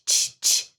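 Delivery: "t, t" as pure breathy unvoiced clicks; teacher showing a voiceless "t". Shown as a demonstration. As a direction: whisper the "t" with no voice. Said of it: "Não é t".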